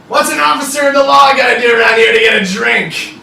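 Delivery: high, full-voiced holler across a bar; loud demanding shout. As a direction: bellow it like a brash lawman wanting service. Devil officer calls out in bar.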